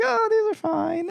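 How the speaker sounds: imitated mom, very high-pitched pretense; insincere reassurance. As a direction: play it falsely sweet, voice way up. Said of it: "She was not genuine".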